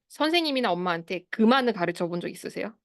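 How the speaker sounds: ordinary delivery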